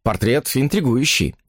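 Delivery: plain reading voice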